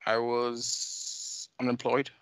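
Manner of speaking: ordinary talk, nothing marked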